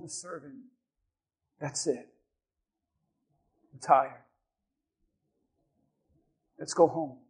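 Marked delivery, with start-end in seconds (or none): 1.6-7.2 low, subdued voice of someone giving up; weary resignation. Retold or imitated. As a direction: act out a tired man calling it quits, lower and softer. Tired Saul giving up to servant.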